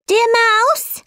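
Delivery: high, pleading call. A narrator's reading. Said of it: "called Alice".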